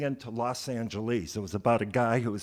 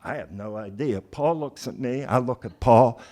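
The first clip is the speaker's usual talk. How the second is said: ordinary talk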